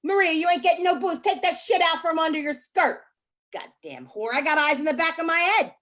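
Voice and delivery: loud mock yelling at the kids; mama screaming. Mama yelling at her kids in the store aisle. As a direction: holler at the kids across the aisle. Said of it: "mama's screaming".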